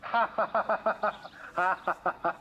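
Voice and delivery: high, forced staccato cackle; maniacal fake laugh. Real, not acted. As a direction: burst into a long, put-on mocking laugh. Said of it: "maniacal laughter sounds fake".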